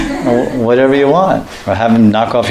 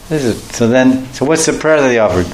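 ordinary talk

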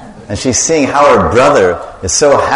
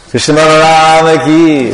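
higher, louder call for the response; devotional call; 5 semitones higher, 4 dB louder than usual. Real, not acted. Leader calling the temple crowd to answer Jai.

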